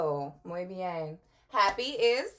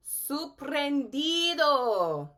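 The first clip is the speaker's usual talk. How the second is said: slow, drawn-out amazed Sorprendido; mock surprised; 5 semitones higher, 4 dB louder, much slower than usual. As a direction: stretch it with wide-eyed wonder. Says surprised word surprised.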